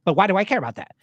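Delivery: fast, loud rapid-fire debater; Ben Shapiro impression. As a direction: rattle it off fast and loud, point by point. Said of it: "I also wanted to practice my Ben Shapiro".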